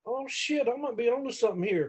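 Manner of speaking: high, soft, surprised aside to himself; a quiet surprised thought from the mat; hushed realization. Retold or imitated. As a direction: murmur it with sudden glee. Lying in the ring, realizing.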